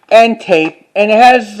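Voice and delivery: ordinary delivery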